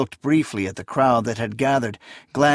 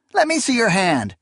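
put-on sly young thug, pitched up; higher menacing boy voice; 9 semitones higher than usual. A narrator's reading. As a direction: demand it with a leer. Boy demands her hand.

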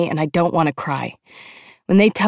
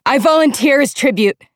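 high, loud, desperate announcement; urgent declaration; 8 semitones higher, 5 dB louder than usual. A narrator's reading. Sister volunteering in her sibling's place, urgent cry. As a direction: cry it out so the whole square hears. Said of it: "I gasp".

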